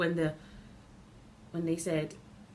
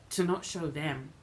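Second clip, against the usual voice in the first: ordinary talk, nothing marked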